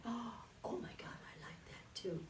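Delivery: whispered, breathy delight at the buttons; secret whisper. Retold or imitated. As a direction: hush it like a child plotting. Child's secret thought while taking buttons.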